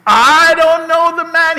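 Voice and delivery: loud, high mock outburst; acted angry denial. Acting Simon Peter denying Jesus, hammed-up loud denial. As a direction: blurt out Peter's denial at full volume. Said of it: "He started cussing".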